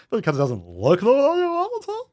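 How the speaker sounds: imitating the video guy. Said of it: "Is that good".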